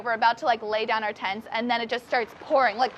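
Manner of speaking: ordinary talk, nothing marked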